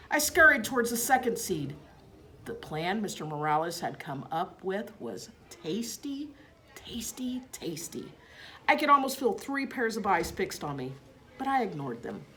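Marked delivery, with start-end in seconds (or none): 5.5-8.1 hushed, savouring whisper; whispered relish. A narrator's reading. Hamster savours the seeds in a whisper. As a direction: whisper it like a treat.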